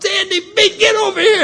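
very high, loud holler; yelling out. Retold or imitated. Doorman hails a friend from the hotel front, yelling out. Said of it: "and he yells out".

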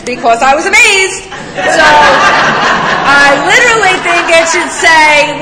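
high, loud, laughing outburst; hammed-up disbelief at being amazed too soon; excited laughing rant. Real, not acted. Telling the room about the promises, laughing loud and high.

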